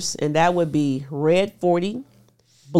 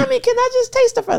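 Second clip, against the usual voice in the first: put-on high, fast pleading kid voice, much higher and louder; begging child; 5 dB louder, faster than usual. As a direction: imitate a little girl begging for fries. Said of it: "She wants".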